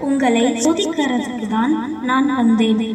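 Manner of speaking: put-on a gentle heavenly being blessing the children; the whale reveals itself as an angel, low, soft and solemn. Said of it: "நான் ஒரு தேவதை".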